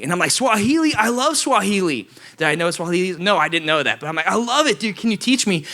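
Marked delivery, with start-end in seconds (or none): 0.3-2.1 high, gushing fake enthusiasm; fake excitement. Faking excitement to stall. 4.3-5.6 fake excitement. Faking excitement to stall.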